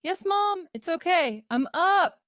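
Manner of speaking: high, loud reply through a door; shouting back. A narrator's reading. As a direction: shout back to mum that it's all fine. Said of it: "Peter shouted back through the door".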